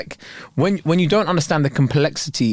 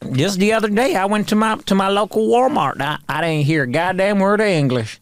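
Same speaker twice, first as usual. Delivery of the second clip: mock American accent; 3 semitones higher than usual. Playing a local griping about Walmart, mock American accent. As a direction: grumble in a put-on American accent.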